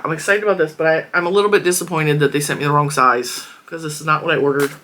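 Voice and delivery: ordinary talk, nothing marked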